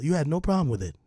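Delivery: normal talking voice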